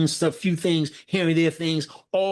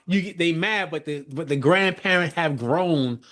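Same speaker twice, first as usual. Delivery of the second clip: ordinary talk, nothing marked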